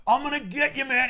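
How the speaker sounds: high, loud mock thug threat; hammed-up brute yelling a threat; party animal thug. Party animal goon threatens Batman in a high loud voice. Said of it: "a huge party animal comes out, and he's like".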